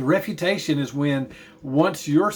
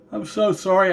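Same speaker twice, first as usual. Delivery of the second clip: talking through a yawn; 5 semitones higher, 3 dB louder than usual. Real, not acted. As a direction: say it mid-yawn. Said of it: "I'm yawning".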